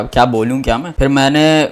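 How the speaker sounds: normal talking voice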